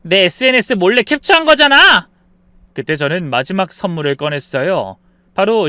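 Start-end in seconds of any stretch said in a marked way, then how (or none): 0.0-2.1 high, loud accusation; acted outraged sister-in-law; indignant shouting. A narrator's reading. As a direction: yell it in protest at being exposed. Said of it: "소리쳤죠".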